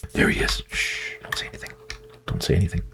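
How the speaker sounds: hushed unvoiced stealth whisper; sneaking so the pig won't hear. Real, not acted.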